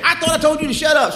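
high, loud scolding; angry parent. Retold or imitated. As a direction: snap at the kid.

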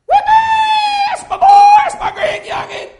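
shrill-free high falsetto cheering grandma; grandma shout. Retold or imitated. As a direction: scream it like a proud grandma in the stands. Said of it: "grandmas over there saying".

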